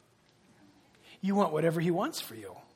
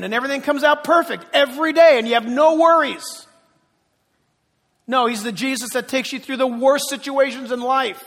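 loud, rising pulpit delivery; preaching at full force; 5 semitones higher, 12 dB louder than usual. Acted. Preacher building the sermon to its climax, loud and driving.

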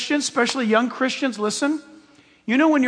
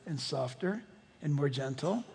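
fading to much softer and lower; 8 semitones lower, 13 dB quieter than usual. Shown as a demonstration. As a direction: let the voice sink away gently. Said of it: "his voice starts getting softer and softer".

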